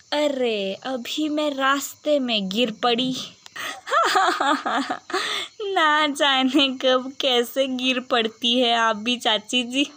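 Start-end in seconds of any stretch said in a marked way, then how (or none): 3.5-5.7 high cackling laugh. Acted. Bride laughing at the fallen aunt. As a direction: laugh out at her fall.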